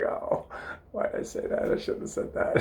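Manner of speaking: voiceless, rueful aside; acted embarrassed regret; regretful self-talk. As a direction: kick yourself under your breath. Retold regret after embarrassing blurt.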